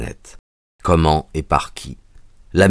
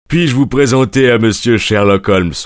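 Gregson; 5 semitones higher, 5 dB louder than usual. A narrator's reading. Gregson présente le détective au cocher.

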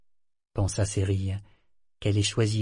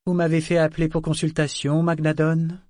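formal, stiff courtesy, raised voice; formally polite question; ceremonious; 6 semitones higher, 4 dB louder than usual. A narrator's reading. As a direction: address him with cold protocol. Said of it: "lui demanda-t-elle cérémonieusement".

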